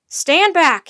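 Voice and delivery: very loud, high captain's order; shouting. A narrator's reading. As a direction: shout the crowd back. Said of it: "he shouted".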